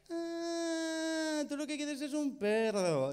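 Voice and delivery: relieved shopkeeper; dawning realization. Retold or imitated. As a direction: finally get it. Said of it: "clerk finally understands".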